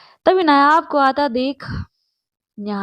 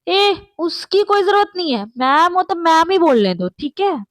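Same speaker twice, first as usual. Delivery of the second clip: higher and louder, snapping in jealousy; jealous, raised-voice interruption; loud jealous outburst; 6 semitones higher, 5 dB louder than usual. Acted. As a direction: cut in loudly to stop them. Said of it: "नायाब जोर से कहती है कि".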